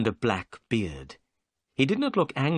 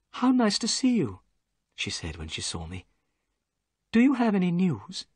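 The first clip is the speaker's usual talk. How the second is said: higher lady's voice, bright welcome; 8 semitones higher than usual. A narrator's reading. Miss Morstan greets the narrator, higher female voice.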